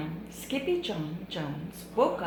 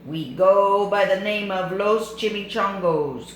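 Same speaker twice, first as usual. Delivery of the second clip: mild growl; 3 semitones higher, 8 dB louder than usual. Acted. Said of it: "growled Don Diego".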